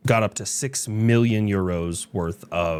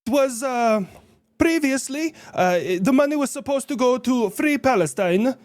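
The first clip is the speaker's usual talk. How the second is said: high character voice. Acted. Character voice in roleplay.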